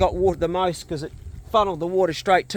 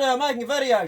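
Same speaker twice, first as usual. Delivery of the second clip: scolding the dog; 9 semitones higher than usual. Real, not acted. Scolding dog mid-video.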